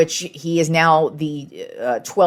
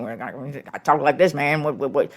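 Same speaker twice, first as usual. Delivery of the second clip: fast, barely understandable Boomhauer-style Southern accent, a rough imitation; quick mock Boomhauer-style accent with words run together; Boomhauer-style accent as Madison Bumgarner. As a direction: rattle it off quickly like Boomhauer from King of the Hill, hard to make out. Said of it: "hilarious accent where he sounds like, Boomhauer".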